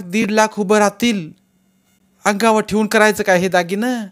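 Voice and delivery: put-on high, loud, proud old woman; old grandmother voice. A narrator's reading. Grandmother offers her gold.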